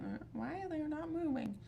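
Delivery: put-on high, puzzled little boy; worried kids' voice. Retelling the boys' call about the fish, high and puzzled. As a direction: lift it up like a worried child. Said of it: "they were reporting ... and saying".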